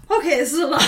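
mock-overwhelmed, breaking into laughter; overwhelmed, laughing. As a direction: blurt it out, laughing at being overwhelmed. Overwhelmed character reacting, acted.

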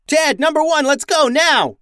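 loud, high command to run; urgent shout. A narrator's reading. Max yells at the crew to flee, urgent. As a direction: hurry the crew out.

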